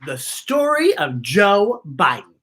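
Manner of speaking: plain reading voice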